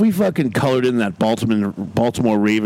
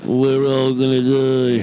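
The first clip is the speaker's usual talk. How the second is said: slow, drawn-out mock wail; hammed-up doomsday moan, held long; mock doom; much slower than usual. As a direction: stretch it into a monotone cry of doom. Mock doom chant at virus news.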